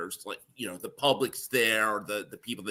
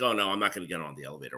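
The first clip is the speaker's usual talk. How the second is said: fast mumbled excuse; 4 semitones lower, 5 dB quieter, much faster than usual. Retold or imitated. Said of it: "people are like".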